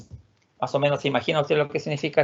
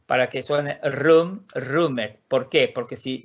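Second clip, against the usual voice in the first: normal talking voice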